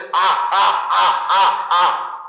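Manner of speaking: loud, separated taunting "ah" laughs; big fake laugh, one punched beat at a time; mocking laugh. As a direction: imitate the devil's jeering cackle, loudly. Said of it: "se fue así burlándose".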